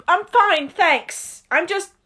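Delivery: a hint of a startled boy backing off; Harry voice. Harry brushing off the witch, lightly nervous.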